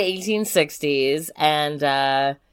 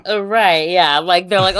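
ordinary talk, nothing marked

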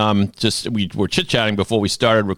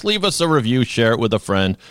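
ordinary delivery

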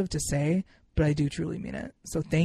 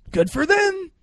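mock cheery; 14 semitones higher, 9 dB louder, slower than usual. First take, loud and bright.

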